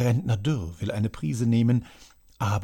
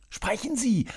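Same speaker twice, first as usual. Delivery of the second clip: much higher, insistent echo; urgent repeat; 11 semitones higher than usual. A narrator's reading. Herr Kreuz echoes the demand, high insistent repeat. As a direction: press him again, pitched up high. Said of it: "wiederholt der Herr Kreuz".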